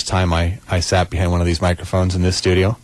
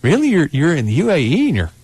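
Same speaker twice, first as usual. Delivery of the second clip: retold amazement, pitched high on each "really"; freaked-out disbelief; 8 semitones higher than usual. Host recalling a caller from the Emirates, mock-incredulous. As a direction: act out being astonished by a far-off caller. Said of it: "we were just kinda freaked out".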